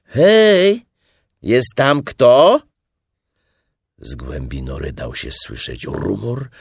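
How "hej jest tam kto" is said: loud, high holler into a burrow; high, drawn-out call. A narrator's reading. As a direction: shout to find out if anyone is in. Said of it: "zaczął wołać".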